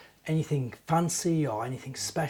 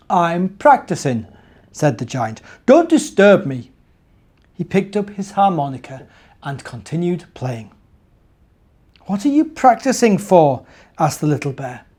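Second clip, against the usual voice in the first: gentle storybook narration; reading to children; 2 semitones higher, 10 dB louder than usual. A narrator's reading. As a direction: read it like a bedtime story.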